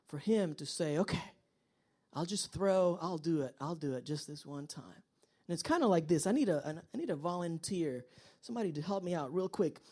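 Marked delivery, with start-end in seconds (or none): 1.0-5.0 low, soft acted giving-in; reluctant trust. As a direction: give in quietly, voice sinking. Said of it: "it took a lot of trust".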